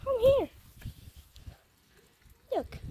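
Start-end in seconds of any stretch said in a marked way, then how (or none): 0.0-0.5 high, loud call to the cat. Real, not acted. Owner calling her cat over, high and loud.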